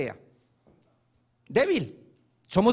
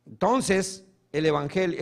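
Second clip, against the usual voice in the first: ordinary talk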